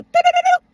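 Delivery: high, loud rapid-fire doo-doo sound effect; imitated rapid noise. Storyteller acting out going to the girl's car window, imitated noise. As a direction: punch out a quick high string of doos.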